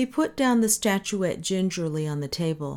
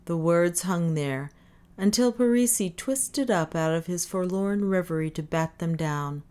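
ordinary delivery, nothing marked

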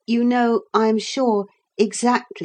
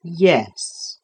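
slowly; 2 semitones lower than usual. A narrator's reading. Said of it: "said Caesar slowly".

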